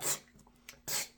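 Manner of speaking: short spitting psst sounds; tobacco spitting noise. Retold or imitated. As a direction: spit out the side of the mouth. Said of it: "spitting tobacco out".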